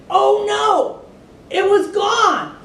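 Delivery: very high, loud, slow oh no; dismayed gasp. A narrator's reading. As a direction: shock as the fur is missing. Lion finds his skin gone.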